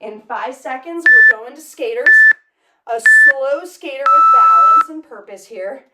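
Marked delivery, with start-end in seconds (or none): 1.1-5.9 loud call. Real, not acted. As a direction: coach calling over the music. Coach calls out next move.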